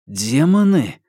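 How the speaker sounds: disbelieving one-word question; doubting, cut-off question; incredulous. A narrator's reading. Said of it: "недоверчиво начал Марк".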